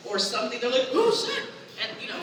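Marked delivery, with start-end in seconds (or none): 0.9-1.4 sudden high yelp of alarm; startled, pitched-up exclamation, hammed up; mock parental alarm. Parent seeing kid falling off couch, mock high alarm. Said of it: "much like parents, when their kids are falling".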